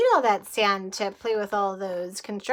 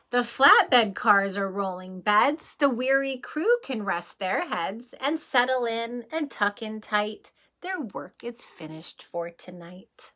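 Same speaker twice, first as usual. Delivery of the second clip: storybook reading; 4 dB louder than usual. Acted. Reading the bedtime page to children, gently fading read-aloud. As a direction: wind the crew down to sleep. Reads picture book aloud.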